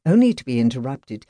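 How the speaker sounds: plain reading voice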